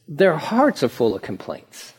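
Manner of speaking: usual speaking voice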